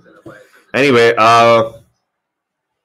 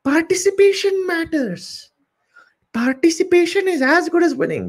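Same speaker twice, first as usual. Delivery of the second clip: much higher, singsong mock teacher voice; imitating primary teacher. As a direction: parrot a sugary schoolteacher's slogan. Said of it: "as your teacher from second standard would say".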